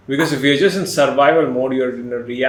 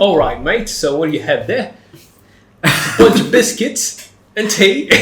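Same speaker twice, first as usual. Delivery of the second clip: mock British accent; 4 semitones higher than usual. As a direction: play a cartoon Englishman offering biscuits. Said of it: "That's British?".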